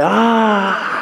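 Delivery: high mock cry of frustration; a sudden high wail of annoyance; frustrated cry. Rain falling all around but not in the mouth, a high frustrated cry.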